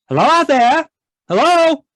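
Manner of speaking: high, loud call into the night. Acted. Husband calling out the back door into the night. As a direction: call out into the dark.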